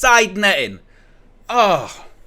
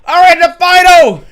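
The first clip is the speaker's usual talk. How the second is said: excited shout; 6 semitones higher, 6 dB louder than usual. Real, not acted. Celebrating England reaching final.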